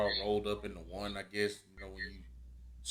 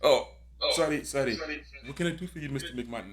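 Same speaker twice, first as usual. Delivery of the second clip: higher, apologetic put-on voice; meek Apollo; 5 semitones higher, faster than usual. Apollo Crews apologizing to Vince, in a higher meek voice. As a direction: plays Apollo grovelling to the boss.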